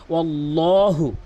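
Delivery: ওয়াল্লাহু slowly, with a full, heavy ll; correct Quran recitation. Shown as a demonstration. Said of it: "এখানে আপনি মনোযোগ দিন".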